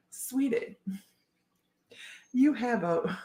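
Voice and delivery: put-on soft Southern accent, a touch breathy; Southern accent, sweet but condescending. Reading the Karen card aloud in a soft Southern accent. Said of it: "only done properly in a Southern accent".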